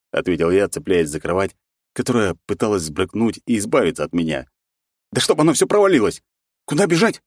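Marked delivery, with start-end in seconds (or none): 5.1-7.2 high, loud panicked cursing. Acted. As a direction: swear in a panic. Friend curses during earthquake.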